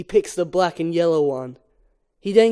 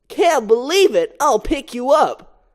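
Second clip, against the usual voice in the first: Barry voiced; 6 semitones higher, 4 dB louder than usual. Barry to Adam.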